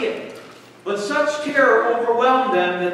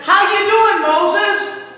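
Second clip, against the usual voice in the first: much higher and louder, a hearty hello; cheery greeting; 12 semitones higher, 8 dB louder than usual. Acted. Preacher voicing Jesus greeting Moses and Elijah, hearty and loud. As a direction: greet old friends with big delight.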